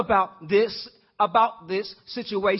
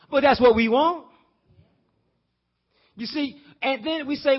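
ordinary talk

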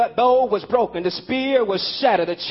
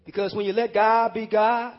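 much softer, lower and unhurried; gentle reflective preaching; 5 semitones lower, 4 dB quieter than usual. Acted. Preacher guides the congregation to let go, softly. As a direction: ease the room into letting go.

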